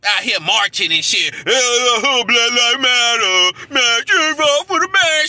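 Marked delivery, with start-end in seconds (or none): 1.3-5.3 loud, drawn-out mock chant; jeering mock rally cry; mocking protesters. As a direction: parody a crowd of marchers at full volume. Mocks marchers' chanting.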